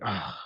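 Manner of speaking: quiet low ugh; low disgusted groan. Retold or imitated. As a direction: groan at a stranger's chatter. Reaction to Go Steelers.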